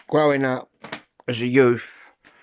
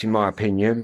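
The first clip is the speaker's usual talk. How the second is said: normal talking voice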